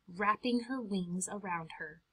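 usual speaking voice

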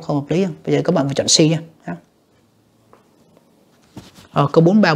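ordinary talk

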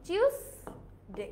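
টিউসডে as "chyuus... day", drawn out slowly; broken-down pronunciation. Shown as a demonstration. Teacher spelling it out for learners, slow CH pronunciation. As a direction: stretch CHYUS, pause, then day in টিউসডে.

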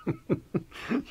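high, brief relieved chuckle; short laugh. Acted. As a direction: laugh off the worry. Laughs at friend's joke.

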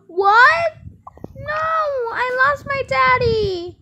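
high, loud, drawn-out mock wail; pretend wailing. Child told Daddy is dead, play-acted wailing.

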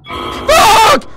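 sudden high, very loud yell; jump-scare shriek; startled scream. Real, not acted. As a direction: explode in a high-pitched shock.

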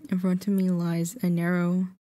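usual speaking voice

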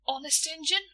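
high, eager rising Honest Injun; eager question. Acted. Boy checking shopkeeper's promise, eager rising question.

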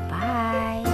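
ordinary talk

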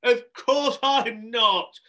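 very slow, high, loud retort; drawn-out, fed-up shout; exasperated cry. Acted. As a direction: cry it out word by word. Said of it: "cried Shelley".

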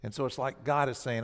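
ordinary talk

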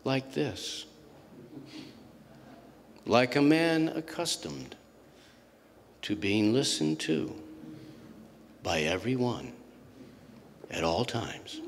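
slow and quiet, long pauses between phrases; measured, hushed voice of a man used to being heard; slow commanding talker. Retold or imitated. Storyteller showing how the small powerful man talked, slow and quiet. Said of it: "but he talked like this".

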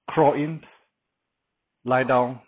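ordinary talk

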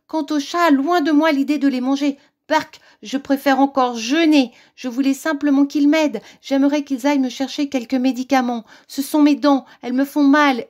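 high, loud whining wolf; pleading wolf. A narrator's reading. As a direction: beg the witch as a cornered storybook wolf.

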